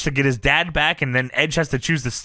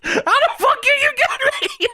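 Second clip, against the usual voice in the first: very high exasperated mock whine; hammed-up squealing complaint through laughter; Paul Bearer. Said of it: "and Paul Bearer says".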